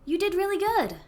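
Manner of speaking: high, coaxing reassurance; cooing comfort. Acted. Comforting Kareev on the verge of tears, high cooing voice. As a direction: coo it high, as to someone about to cry.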